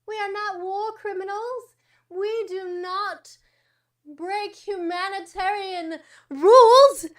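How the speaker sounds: high sarcastic voice of a lying official; mock innocent denial. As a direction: play the wide-eyed liar, then swell into slow indignant protest. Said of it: "they're like".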